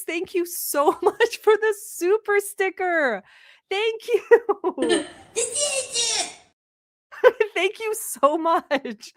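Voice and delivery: slow, giggly thank-you; real laughter, drawn-out thanks; laughing thanks. Real, not acted. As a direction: get the thanks out through laughter. Laughing while thanking a viewer.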